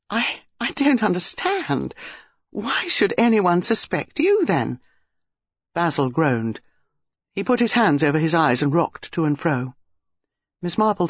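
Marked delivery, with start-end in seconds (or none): 0.1-4.8 high, slow, halting confusion; bewildered. A narrator's reading. Dinah cannot understand why Basil is suspected, bewildered. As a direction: stumble into the question, baffled.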